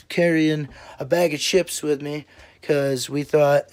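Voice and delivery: ordinary delivery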